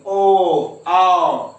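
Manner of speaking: "ओ" slow and rounded, then "आओ" loud; vowel sound demo. Shown as a demonstration. Teacher showing the throat-and-lips vowel, slow then loud. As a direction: draw out "ओ", then call "आओ". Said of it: "बोल के देखिए".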